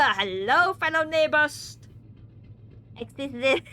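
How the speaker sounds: usual speaking voice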